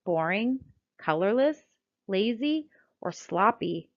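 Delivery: ordinary delivery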